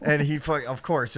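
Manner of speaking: normal talking voice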